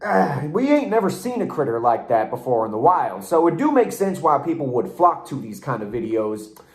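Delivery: normal talking voice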